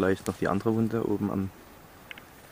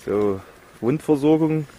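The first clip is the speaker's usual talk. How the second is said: Ansage; 6 semitones higher, 7 dB louder, slower than usual. Acted. Announces wound care to camera.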